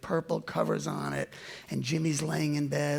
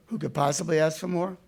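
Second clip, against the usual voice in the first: ordinary delivery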